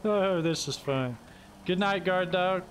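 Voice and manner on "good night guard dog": ordinary talk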